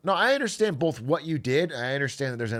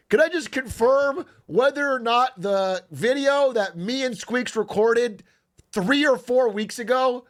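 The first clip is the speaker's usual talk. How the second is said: exaggerated polite boss, raised and slow, at x0.7; hammed-up passive-aggressive inquiry; mock formal complaint; 7 semitones higher, 4 dB louder than usual. Host mock-questioning the editor about the late video, exaggerated politeness.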